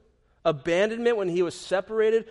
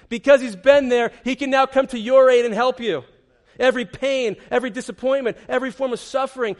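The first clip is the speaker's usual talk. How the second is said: louder, ringing declaration; loud preaching; 6 semitones higher, 8 dB louder than usual. Real, not acted. Preacher proclaiming Jesus helps, loud and ringing. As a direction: lift it to the rafters.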